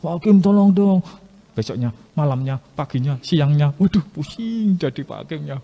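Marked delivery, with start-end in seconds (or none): 0.0-1.0 pleading widow. Acted.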